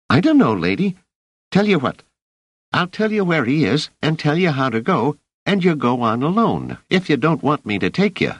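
put-on high boy's voice, cheeky and disappointed; a scrappy street urchin egging her on; street kid. Rache bargains.

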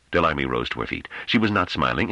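plain reading voice

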